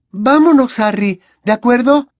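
insistent Hermione. A narrator's reading. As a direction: push him to agree. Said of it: "insistió Hermione".

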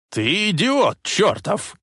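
high, slow, shrieking yell; old man shrieking at his careless grandson; shrill shout. A narrator's reading. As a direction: screech it at him. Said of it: "визгливо закричал он".

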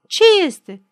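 high, loud question; crowd calling out. A narrator's reading. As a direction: call the question out over the noise.

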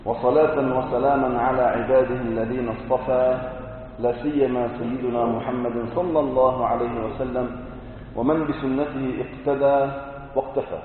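solemn preacher's invocation; formal opening invocation. Acted. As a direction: intone the opening praise gravely. Formal opening praise formula.

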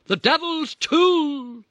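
very high, slow, loud zealot wail; protesting mother. Retold or imitated. Mother protesting to dad about the game, slow high wail. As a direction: stretch it out like a fanatic. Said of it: "she protested".

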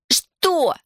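high, irritated shout; angry snap. Acted. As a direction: snap back furiously.

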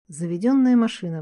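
ordinary delivery, nothing marked

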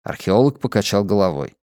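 ordinary delivery, nothing marked

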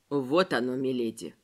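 low hushed servile voice. Acted. Servant hands over the bust.

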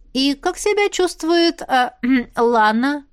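slow, high, drawn-out inquiry; teasing mock-innocence. A narrator's reading. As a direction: feign casual interest, hesitating coyly before the name. Teasing question to smitten brother.

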